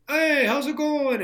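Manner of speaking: high, loud, drawn-out street holler; calling out greetings. Retold or imitated. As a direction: shout hello across the block. Crew greeting him on every block.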